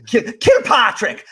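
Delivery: high, slow KILPATRICK in mock Irish brogue; Irish accent. Shown as a demonstration. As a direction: slip helplessly into an exaggerated Irish KILPATRICK. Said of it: "without doing an Irish accent".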